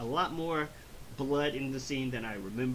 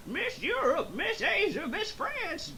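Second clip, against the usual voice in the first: put-on very high, excited old pervert; hammed-up cartoon lech in a high strained voice; Master Roshi; 4 dB louder than usual. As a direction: squeal it with lecherous glee. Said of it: "That's a good Master Roshi impression".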